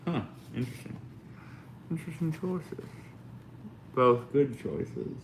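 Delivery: talking around a paintbrush held in teeth; paintbrush in mouth. Real, not acted. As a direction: mumble with a brush between your teeth. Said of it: "I had a paintbrush in my mouth".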